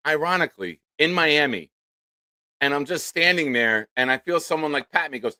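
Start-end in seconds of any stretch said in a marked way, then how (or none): none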